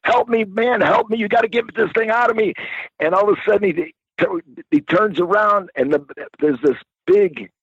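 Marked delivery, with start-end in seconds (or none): 0.0-2.5 high, pleading cry for help; desperate plea. Retold or imitated. Paul locked out naked, begging for help. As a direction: beg for help, panicked. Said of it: "He's screaming".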